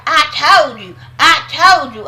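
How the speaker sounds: mock Sunday gloating; smug told-you-so. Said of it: "Sunday like".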